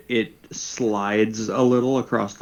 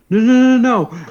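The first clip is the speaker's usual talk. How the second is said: panicked pleading; 13 semitones higher, 8 dB louder, much faster than usual. Acted. Frightened person running to costume.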